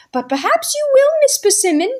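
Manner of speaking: put-on high, bright boy's voice teasing; cheeky young boy. Michael teasing Miss Persimmon, cheeky boy. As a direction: tease her with innocent mischief.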